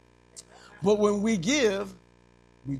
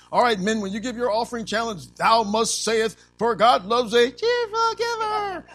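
mock decree; 4 semitones higher, 6 dB louder than usual. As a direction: ham up a stern church order. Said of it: "put a mandate out and say".

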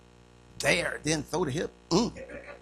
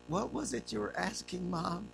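timid; 11 dB quieter than usual. Retold or imitated. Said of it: "I was afraid to go downstairs".

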